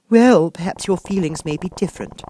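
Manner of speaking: put-on a child asserting herself; Alice. A narrator's reading. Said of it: "said Alice".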